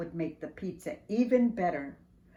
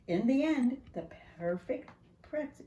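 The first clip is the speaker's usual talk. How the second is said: ordinary delivery, nothing marked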